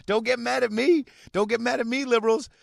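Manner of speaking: high, loud, laughing protest; giggly mock apology to liberals; laughing mock plea. Real, not acted.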